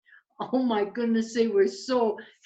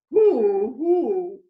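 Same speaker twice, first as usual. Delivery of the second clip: two loud, short owl hoots; loud double hoot; 3 semitones higher, 9 dB louder than usual. Acted.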